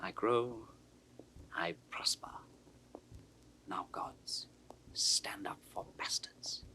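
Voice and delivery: slow, scheming whisper to the gods; whispered plea to the gods, slowly; whispered prayer. Acted. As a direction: breathe it like a dark secret vow. Edmund's soliloquy closing prayer.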